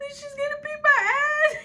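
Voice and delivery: high, loud sobbing wail; mock crying wail. Mimicking the classmate crying to others, high mock wail. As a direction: wail it like a blubbering kid. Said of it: "I remember hearing her say".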